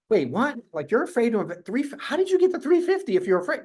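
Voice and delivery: stunned disbelief. Retold or imitated. Coach hearing a scared agent made three fifty, stunned and disbelieving. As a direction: pitch jumps way up in confused disbelief. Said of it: "So I'm, like, lost".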